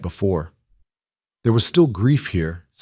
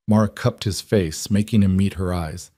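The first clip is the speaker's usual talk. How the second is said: usual speaking voice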